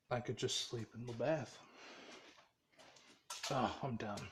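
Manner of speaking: low, soft, worn-out voice; too drained to bother projecting; exhausted. Acted. Worn-out character settles for sleeping in the bath, exhausted. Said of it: "too tired to even, like, think".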